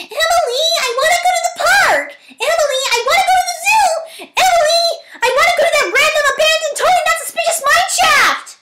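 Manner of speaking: put-on very high, whining child's voice; a little kid pestering Emily, very high and whiny. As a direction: nag like a spoiled little kid. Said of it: "ear bleeding nonsense".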